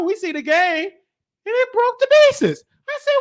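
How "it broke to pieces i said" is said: ordinary talk, nothing marked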